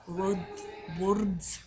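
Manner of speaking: playing with the word in a Scottish accent. Shown as a demonstration. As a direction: practise Scottish-accented words one by one. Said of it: "they were all talking Scottish".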